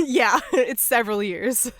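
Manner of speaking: amused, laughing through the words. Real, not acted. Host conceding to co-host that it's been years, laughing. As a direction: laugh as you concede the point.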